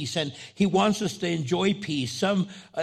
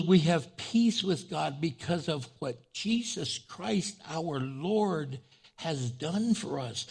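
scripture reading; slower than usual. Acted. Reads Romans passage aloud.

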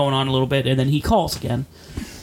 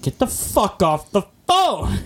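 slower, higher mock yell on "Get the fuck off the phone!"; retold yell; 6 semitones higher, slower than usual. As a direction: drag it out like a cop losing patience. Herhaalt na tonen van badge.

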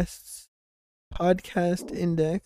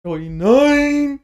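playful shout; 9 semitones higher, 10 dB louder, much slower than usual. Real, not acted. Correcting age, playful shout.

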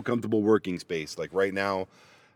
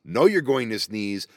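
ordinary talk

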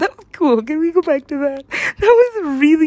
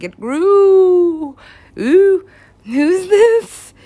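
high, loud, drawn-out oohing; wowed, voice swooping up; acted amazement; 7 semitones higher, 5 dB louder, much slower than usual. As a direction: coo loudly in high-pitched wonder. Acting out own amazed reaction.

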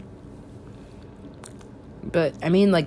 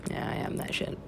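voiceless confiding whisper; whispered aside; 12 dB quieter than usual. Real, not acted. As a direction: whisper it like a confession. Self-mocking whispered aside.

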